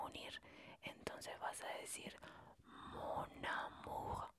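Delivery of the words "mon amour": mon amour slowly linked, N joined to A as one word; liaison. Shown as a demonstration. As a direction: glide mon amour together with no break, slowly. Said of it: "como si fuera, una palabra sola".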